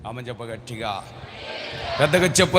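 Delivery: ordinary delivery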